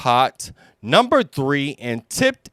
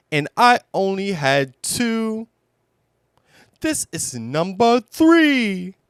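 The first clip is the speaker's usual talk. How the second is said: gentle Pooh bear voice; 10 semitones higher than usual. Acted. Pooh explaining his lost honey pot, high bear voice. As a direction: speak as a sweet, simple little bear, much higher.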